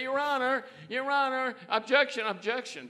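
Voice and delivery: high, loud mock whine; whiny lawyer. Lawyer whining objections at the judge, high mocking whine. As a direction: ham up a panicky objecting lawyer. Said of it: "John Wayne would never say".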